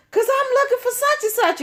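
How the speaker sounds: friend's excuse. Retold or imitated.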